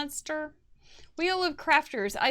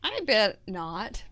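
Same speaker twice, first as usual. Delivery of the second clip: normal talking voice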